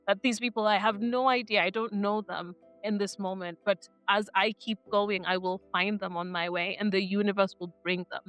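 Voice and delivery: ordinary delivery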